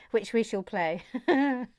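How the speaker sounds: talking through giggles; real, bubbling laughter around the words. Real, not acted. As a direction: break into giggles between phrases. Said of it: "got real giggles today".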